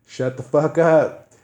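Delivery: loud, slow, repeated yell; angry yell. Retold or imitated. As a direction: shout him down each time. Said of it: "every time he would try to talk".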